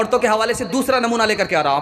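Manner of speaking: ordinary talk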